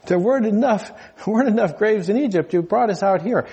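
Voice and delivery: a hint of a high, complaining Jackie Mason; Jackie Mason style complaint. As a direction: whine it like a standup comic. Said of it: "prehistoric Jackie Mason who goes up to Moses".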